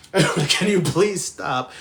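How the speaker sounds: slow, loud desperate plea; pleading yell. Retold or imitated. Passengers pleading with swerving cab driver, loud and slow. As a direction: beg loudly over the swerving. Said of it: "So now we were like".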